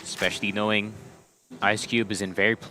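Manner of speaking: ordinary talk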